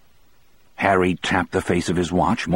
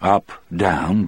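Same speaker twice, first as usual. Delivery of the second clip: ordinary delivery, nothing marked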